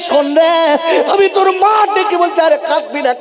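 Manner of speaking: mother calling. Acted. Mother calls her children.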